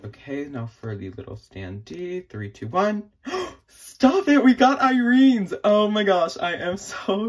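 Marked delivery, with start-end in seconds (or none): 3.2-7.3 much higher and louder, screaming with joy; ecstatic shriek. Real, not acted. Vlogger pulls her favourite member's standee, shrieking with joy. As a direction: shriek like you won the lottery.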